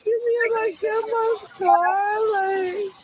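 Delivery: sobbing through the words; tearful, slow, high and pleading; crying. Real, not acted. Caller in distress to police.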